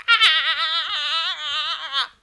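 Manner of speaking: loud high cry of pain; mock pain yelp. Person hit by sudden pain, crying out loud. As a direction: yelp like it hurts.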